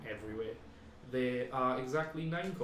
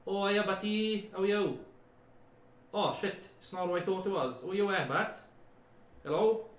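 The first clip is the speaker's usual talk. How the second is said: lightly voiced higher call from the cave; little voice calling out; 6 semitones higher, 3 dB louder than usual. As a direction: call out in a small voice. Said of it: "a little voice call out and go".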